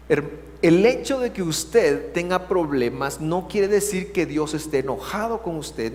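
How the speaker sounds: ordinary delivery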